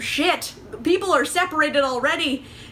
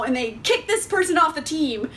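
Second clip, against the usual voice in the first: usual speaking voice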